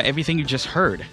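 ordinary delivery

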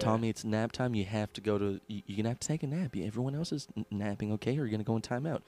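soft, low teacher voice; hushed nap-time instruction, patient but firm; kindergarten teacher. Acted. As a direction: talk a pupil down quietly. Teacher telling Tommy to nap.

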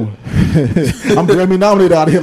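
normal talking voice

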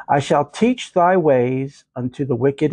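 ordinary talk, nothing marked

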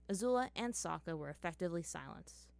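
usual speaking voice

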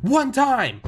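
high, loud sarcastic rant; ranting comic. Retold or imitated. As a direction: rail at the audience like a stand-up comic. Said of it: "It's like".

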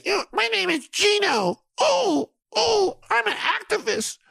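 very high mocking falsetto; Gino. Annoyed host mocking his activist friend, high falsetto introduction. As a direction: ridicule an activist friend in a squeaky-high put-on voice. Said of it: "Gino is an activist in Baton Rouge".

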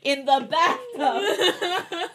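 high, slow, laughing delivery. Real, not acted. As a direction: laugh out the words. Teasing joke while laughing.

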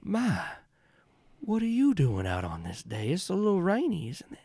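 a sweet-talking charmer greeting strangers; genteel stranger voice. Acted. GM stranger continues.

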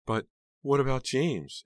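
high, loud, slow plea; distressed, girlish aunt. A narrator's reading. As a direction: plead helplessly in a high voice. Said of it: "She sounds like a little girl".

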